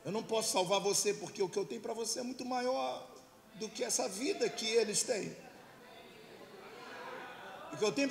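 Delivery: God pleading. Acted. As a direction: plead urgently with a son to come back. God pleading with his son.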